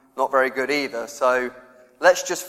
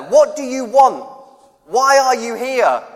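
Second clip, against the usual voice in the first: higher, louder, slow bellowed demand; yelled question; 9 semitones higher, 6 dB louder, slower than usual. Retold or imitated. Big bloke in flame light shouts at newcomers, slow and loud. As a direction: bark it like a big bloke at a night camp. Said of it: "'cause someone's yelling at you".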